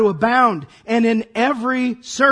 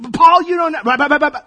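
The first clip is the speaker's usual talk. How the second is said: higher, louder whining; whiny complaint; 5 semitones higher, 7 dB louder, faster than usual. Retold or imitated. Churchgoer whining back at Paul, loud and high. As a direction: whine it like a fed-up churchgoer.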